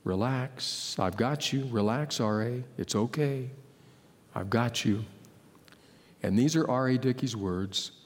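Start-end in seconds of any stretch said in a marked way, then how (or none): 0.0-5.0 softer, low and gentle, as a comforting voice; soft reassuring voice. A narrator's reading. The Holy Spirit reassuring a shaken pitcher, soft and gentle. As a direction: let the calm voice settle him down. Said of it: "I hear a voice".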